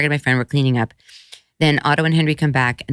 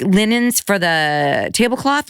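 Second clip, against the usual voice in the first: ordinary delivery, nothing marked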